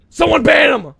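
high, loud demand to the mods; hammed-up angry call; mock-angry shout. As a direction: yell it as an outraged order. Angry order, then just kidding.

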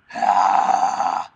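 loud airy open-mouth sigh; open-throat sigh demo; breathy open sigh. Shown as a demonstration. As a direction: let the air out open like a sigh. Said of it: "you wanna open up your mouth".

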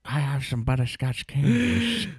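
a lightly voiced old granny, slow; old lady voice. Co-host joining the old lady bit, slow and lightly voiced. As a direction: slowly offer candies like a granny.

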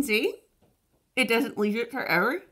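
talking with fingers in mouth; fingers stretching lips. Real, not acted. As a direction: lips pulled open. Seen 4: Fingers pull lower lip down, then touch the outstretched tongue; later repeats.

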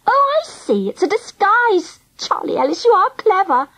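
put-on high, swooping voice of a delighted girl; delighted realization. A narrator's reading. Angela praising Charlie's disguise idea, delighted and high. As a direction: let the penny drop, high and impressed.

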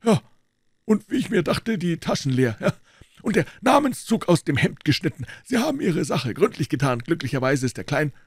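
a hint of a composed doctor; the doctor explaining why he came in, lightly voiced. A narrator's reading. Doctor speaking in the story.

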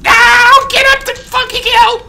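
very high, drawn-out frantic shriek; panicked scream. Real, not acted. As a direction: scream desperately to climb out of reach. Cornered, cannot climb.

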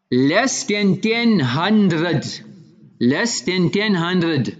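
drawn-out, weighty statement of a price; slow, emphatic. Retold or imitated. Seller telling the buyer his price in clear words, slow and emphatic. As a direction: stretch out "ten hundred", deliberate and firm.

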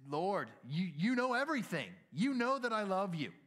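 high, loud, pleading cry; exasperated outcry. Acted. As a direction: cry it out in desperation to be believed. Acting Peter's exasperated third answer.

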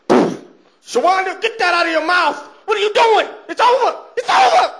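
very high, hysterical yell; mock outraged shouting. Man catches his woman cheating, mock outraged shouting. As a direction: escalate to a squealing, hammed-up fury.

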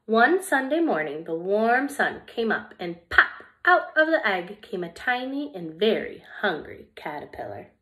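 lively, expressive storybook reading; reading to children. Acted. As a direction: read it like story time for little kids. Reads picture book to children.

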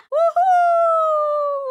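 loud high whooping cheer; thrilled victory yell. Acted. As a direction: let out a big triumphant whoop. Celebration cheer.

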